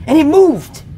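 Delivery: high loud frightened insistence; alarmed high shout. Real, not acted. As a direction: make them believe you, shaken. Frightened insistence about the hand.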